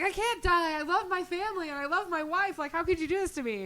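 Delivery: high-pitched, hurried protest to God; acted-out dismay, pitched right up; distraught pleading. Dying man protests to God.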